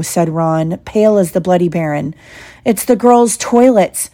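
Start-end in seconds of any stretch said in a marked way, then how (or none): 2.6-4.1 slow, raised-pitch realisation; alarmed gasp. Acted. Harry realises where they locked the troll, alarmed. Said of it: "Harry gasped".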